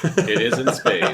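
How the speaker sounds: laughing. Real, not acted. Co-host mocking the sequel plot, laughing. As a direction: laugh as you state the obvious.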